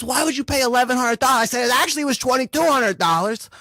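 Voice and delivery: heated back-and-forth. Retold or imitated. Argument with Cal retold.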